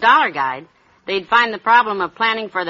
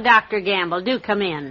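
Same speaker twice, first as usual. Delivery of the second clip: ordinary talk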